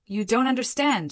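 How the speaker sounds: a hint of a mother wailing; wailed. A narrator's reading. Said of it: "she wailed".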